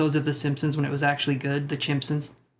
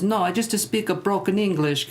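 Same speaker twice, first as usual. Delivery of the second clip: higher-pitched mock Italian accent; 5 semitones higher than usual. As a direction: put on a cartoon Italian accent. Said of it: "and he talks like this".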